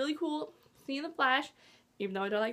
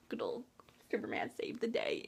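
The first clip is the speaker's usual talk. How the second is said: hushed whisper; 8 dB quieter than usual. Real, not acted. Retelling Lois drowning tension.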